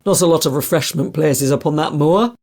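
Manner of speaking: put-on loud Lancashire accent, gruff; northern English accent. A narrator's reading. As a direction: speak with a strong Lancashire accent. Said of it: "He had a strong northern accent".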